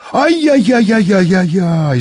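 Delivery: high, drawn-out wail of dismay; acted wail of alarm, slow and high; alarmed cry. A narrator's reading. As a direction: cry out in a high, falling string of yelps. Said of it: "закричал Михаил Антонович".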